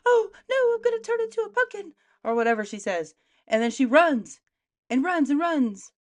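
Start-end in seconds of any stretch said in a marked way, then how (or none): 0.1-1.9 high-pitched, hurried mock wail; Cinderella panicking. Cinderella at the stroke of twelve, mock panic. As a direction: squeal it in hammed-up panic. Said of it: "Cinderella panics".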